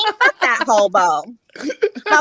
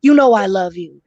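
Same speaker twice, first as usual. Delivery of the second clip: put-on boyfriend voice; 3 semitones lower than usual. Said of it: "And he'll say".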